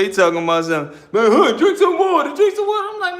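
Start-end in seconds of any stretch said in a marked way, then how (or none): 1.1-2.9 high, lightly voiced cellmate urging; insistent cellmate. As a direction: press the advice in a raised pitch.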